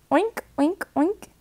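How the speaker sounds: a tiny piglet oinking sweetly; squeaky piglet oinks. Pretend. Pig sounds repeated again.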